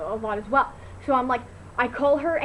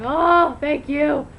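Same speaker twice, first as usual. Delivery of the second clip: higher and louder, blissful relief; relieved sigh of thanks; 4 semitones higher, 8 dB louder, much slower than usual. Retold or imitated. Lying on couch in air conditioning, loud blissful relief. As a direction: gush thanks to the cold air.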